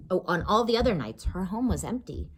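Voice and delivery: usual speaking voice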